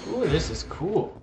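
ordinary talk

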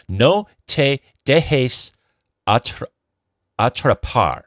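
slow, loud, careful Spanish in an American accent; halting non-native Spanish. Real, not acted. Co-host reads a listener's email sign-off in halting, American-accented Spanish. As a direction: sound out the Spanish word by word, haltingly. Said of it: "my Spanish isn't very good".

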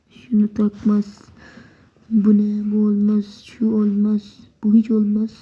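normal talking voice

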